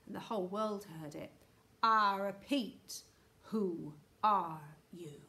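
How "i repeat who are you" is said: slow, loud, stern demand; urgent angry shout. A narrator's reading. Angry Houston voice repeats its challenge, slow shout. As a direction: boom it out word by word, angrily. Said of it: "shouted the urgent, angry voice".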